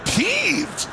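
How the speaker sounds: high, drawn-out mockery of the word; disbelieving echo. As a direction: hold the word up for ridicule. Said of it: "I've never heard anybody even use that word".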